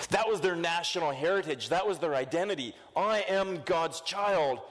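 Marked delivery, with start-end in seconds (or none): 3.0-4.6 slow, higher, drawn-out proud claim. Retold or imitated. The people insisting on their heritage, slow and proud. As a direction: stretch it out like a smug boast. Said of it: "is what the people would say".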